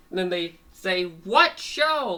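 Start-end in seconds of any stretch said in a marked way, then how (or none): 1.0-2.2 high, loud mock bafflement; baffled outcry. Animals puzzled by Fango's remark, baffled outcry. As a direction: cry out in cartoon confusion.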